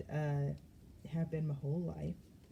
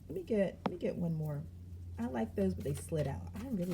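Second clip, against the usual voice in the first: normal talking voice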